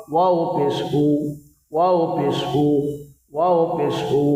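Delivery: "ওয়াও পেশ উ" with the mouth rounded on "উ"; repeated spelling chant, "উ" said round; rounded vowel. Shown as a demonstration. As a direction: drill it evenly, rounding lips for "উ". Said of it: "মুখটা একটু গোল হবে".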